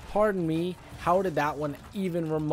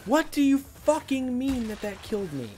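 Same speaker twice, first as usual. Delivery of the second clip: outraged disbelief; 10 semitones higher than usual. Real, not acted. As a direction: demand an answer from the game. Outraged at game death.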